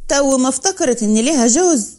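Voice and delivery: ordinary delivery, nothing marked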